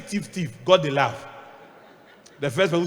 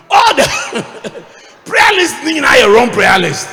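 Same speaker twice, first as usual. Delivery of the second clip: slow shout broken by laughter; real laughing yell; 10 semitones higher, 17 dB louder, much slower than usual. Real, not acted. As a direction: laugh it out at full volume. Preacher laughs and shouts punchline.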